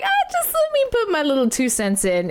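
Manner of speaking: lightly voiced casual singer chiming in; playful singer voice. Reactor jokingly voicing the singer, lightly voiced. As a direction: tease the backup singer a little.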